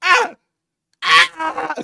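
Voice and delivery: high loud mock screams; hammed-up pain cries; pepper spray screams. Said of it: "they go".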